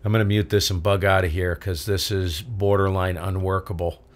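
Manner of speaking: normal talking voice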